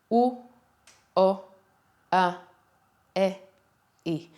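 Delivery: U, O, A, E, I said flat and open, one at a time; five Hebrew vowels. Shown as a demonstration. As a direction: pause between each of U, O, A, E, I. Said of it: "so the five vowels are".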